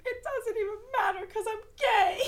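high, loud, crying blurt; tearful outburst. Retold or imitated. Teen shouting back at angry parents, crying. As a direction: burst out through tears to end the lecture.

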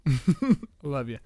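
slow, laughing I love you; laughing fondness. Real, not acted. To his pug after scolding it, slow laughing affection. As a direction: melt into a laugh for the dog.

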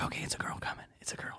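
hushed whisper at the window. Retold or imitated. Two friends sneaking up at night, whispering. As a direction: whisper so nobody inside hears.

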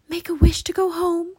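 high, loud urgent plea; crying out. A narrator's reading. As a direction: urge him desperately. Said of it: "cried Annie".

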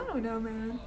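usual speaking voice

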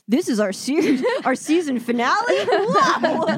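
loud, high laughing surprise; laughing disbelief. Retold or imitated. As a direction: gasp it out through laughter. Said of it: "I'm like".

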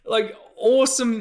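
high, hammed-up furious rant; mock outraged rant. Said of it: "a little bit agitated there".

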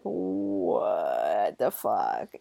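low and slowed right down; slow deep voice. Retold or imitated. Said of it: "you're like".

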